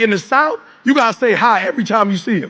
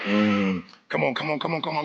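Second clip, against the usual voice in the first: ordinary delivery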